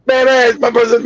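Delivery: usual speaking voice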